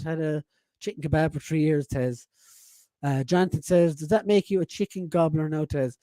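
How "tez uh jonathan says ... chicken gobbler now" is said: usual speaking voice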